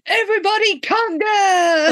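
high, loud party call; rally the whole crowd to conga; excited shout to the dancers; party cheer. Retold or imitated. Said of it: "Shred ... going".

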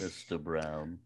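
very low, soft, slow character voice; comic character catchphrase. Retold or imitated. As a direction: drawl it quietly, way down low. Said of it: "his fucking character".

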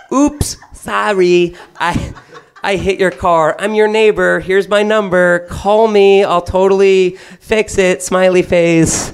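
mock cutesy note voice. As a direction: coo it like a fake-innocent neighbor. Wife's flirty note voiced.

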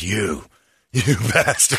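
chuckling, drawn-out you bastard; half-laughing mock curse; laughing mock insult. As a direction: laugh it out, slow and playful. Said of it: "And I'm like".

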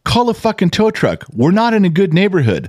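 high, pressing mock yell; urgent order. Father urging his daughter to call a tow truck. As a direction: push her to hurry up. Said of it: "I go".

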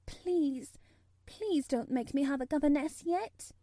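put-on a nervous child begging; pleading girl voice. A narrator's reading. Mary begging.

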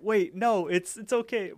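high, urgent plea to stay; rising pleading wait. Retold or imitated. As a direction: pitch it up as she begs him to wait. Margaret calling after Mordecai.

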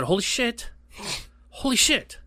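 waking shocked. Retold or imitated. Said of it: "I said".

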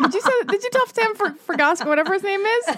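high, loud gleeful prying; excited teasing. Real, not acted. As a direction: pounce on the gossip with delight. Teasing guest about a secret.

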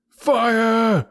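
high, drawn-out alarm cry; yelled cry of fire. A narrator's reading. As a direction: yell it out high and long. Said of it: "we all yelled".

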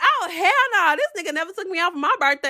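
outraged. Retold or imitated. Seeing balloons in his car, a fast high outraged rant. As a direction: spit it out in jealous outrage.

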